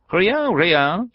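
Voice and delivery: put-on very high French old woman; old peasant woman. A narrator's reading. Peasant woman dismissing guest's thanks, high-pitched voice. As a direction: wave it away in a high voice.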